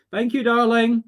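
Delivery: a sudden lift of fondness for the one who went ahead; fond thanks. Real, not acted. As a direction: thank your late wife brightly and with affection. Thanking spirit wife, loud, high.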